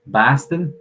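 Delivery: put-on Boston pronunciation; Boston accent. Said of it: "people from Boston would say".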